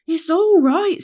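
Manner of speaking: high, loud reply to the group; calling back. Acted. Rainbow answering the ones left behind, high loud call.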